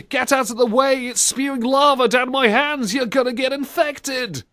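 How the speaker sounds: high-pitched mock screaming; dodgy old geezer. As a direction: rant like a panicked old geezer. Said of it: "dodgy old geezer ... screaming".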